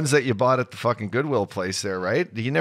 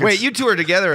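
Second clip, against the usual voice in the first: mock customs officer; 10 semitones higher, 7 dB louder than usual. Voicing customs officer catching them.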